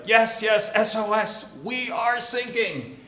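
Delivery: high, loud plea over the radio; urgent distress call. Retold or imitated. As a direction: call for help, stretching out the last words. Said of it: "And they're like".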